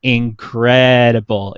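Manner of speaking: ordinary talk, nothing marked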